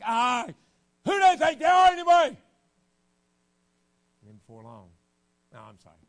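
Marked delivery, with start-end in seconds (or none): 0.0-2.4 high, loud indignant yell; mock teen outburst. Teen yelling about his parents, indignant shout. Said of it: "I'm like".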